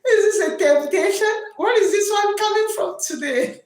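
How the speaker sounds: laughing mock quote. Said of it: "you say".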